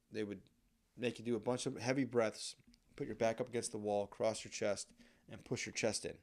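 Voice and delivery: ordinary delivery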